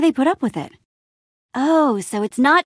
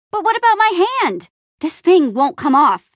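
higher-pitched, insistent complaint; alarmed protest; 7 semitones higher, 4 dB louder than usual. Acted. Protests about stuck hand.

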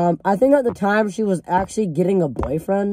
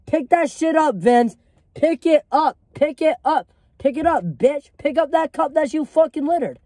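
pitched way up, pushing the repeated order; riled-up retold telling-off, higher throughout; bossy demand to pick up the cup, pitch raised; heated mock yelling; 7 semitones higher than usual. Retold heated group chat clash.

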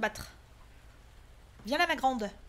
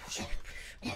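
whispered despair; 7 dB quieter, slower than usual. Real, not acted. Exhausted scared player.

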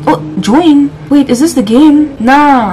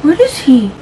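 ordinary talk, nothing marked

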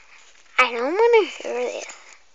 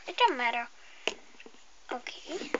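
ordinary delivery

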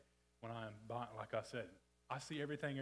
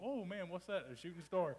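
mock naive wonder; 7 semitones higher, 4 dB louder than usual. Mock naive reaction to missile.

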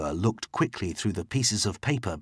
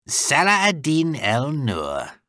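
slow, raised, drawn-out recognition; higher, deliberate greeting to a rival; surprised greeting; 6 semitones higher, 4 dB louder, slower than usual. Acted. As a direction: draw out the name as you spot him. Strengle greets enemy, surprised.

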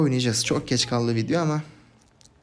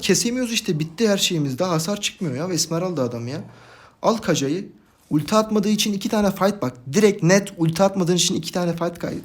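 higher-pitched heated grumbling; exasperated complaint; 7 semitones higher, 4 dB louder than usual. Real, not acted. Streamer blaming teammate for two lost fights, exasperated complaint. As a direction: keep venting, still worked up. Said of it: "Delirtti beni ya".

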